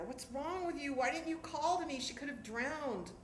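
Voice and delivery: snapping at mom for not calling; retold yell. Father to mother on the beach, a mild retold yell. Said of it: "My father yells at her".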